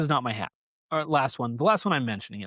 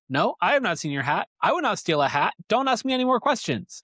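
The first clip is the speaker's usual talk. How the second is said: defensive rabbit; 8 semitones higher than usual. Retold or imitated. Rabbit denies stealing hat.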